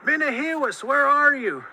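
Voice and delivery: high, loud searching call. A narrator's reading. Coyote calls for unseen Muskrat, loud searching call. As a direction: holler to someone out of sight.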